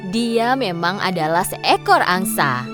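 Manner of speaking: ordinary delivery, nothing marked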